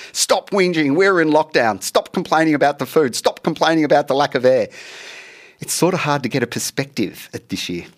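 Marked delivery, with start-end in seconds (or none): none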